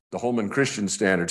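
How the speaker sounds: ordinary talk, nothing marked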